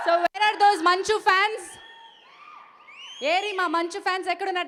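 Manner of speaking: slow, loud shout to the crowd; calling out. Real, not acted. Host shouting for the Manchu fans, loud and slow. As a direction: call out across the hall.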